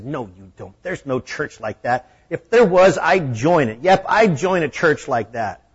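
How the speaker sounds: loud, scoffing, then won over; a gruff cook scoffing at the idea; mocking. A narrator's reading. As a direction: cook mocks a church like that, loud. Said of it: "in a mocking way said".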